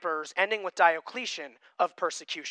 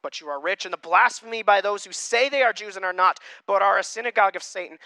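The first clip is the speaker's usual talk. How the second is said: loud, deliberate Bible reading to the congregation; reading Revelation aloud to the church, in a proclaiming voice; scripture reading; 4 semitones higher, 10 dB louder than usual. Acted. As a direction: proclaim the passage from the pulpit. Rereads verse aloud.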